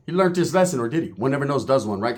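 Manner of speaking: normal talking voice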